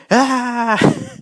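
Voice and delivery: high, loud mock monster "Ah!"; a short hammed-up screen-monster scream; mock monster roar. Reviewer imitating a CGI monster screaming at the audience, hammed-up roar. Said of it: "turning and going".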